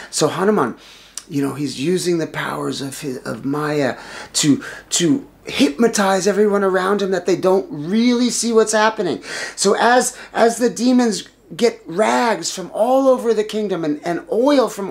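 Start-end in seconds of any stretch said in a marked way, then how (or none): none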